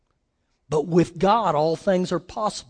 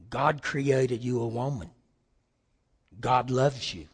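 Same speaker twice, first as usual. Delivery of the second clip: gentle counsel; 9 semitones lower, 9 dB quieter than usual. Retold or imitated. Counselor speaking to abused woman.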